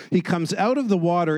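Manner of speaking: ordinary delivery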